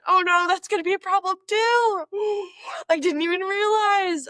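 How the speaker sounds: high, loud cartoonish wail; hammed-up high-pitched panic; mock dismay. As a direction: wail it in exaggerated dismay, gasping midway. Dismayed at own accent slip.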